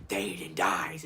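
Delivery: very low, croaking villain. Acted. Saruman threatening through possessed Theoden, very low villain voice. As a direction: drop right down into a menacing rasp. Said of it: "and he's like".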